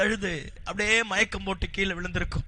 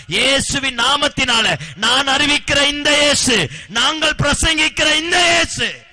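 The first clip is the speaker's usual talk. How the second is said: loud, driving sermon proclamation; preaching at full force; 3 semitones higher, 7 dB louder than usual. Acted. Preacher proclaims Jesus to the evening congregation, loud and driving. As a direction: proclaim it to the whole church.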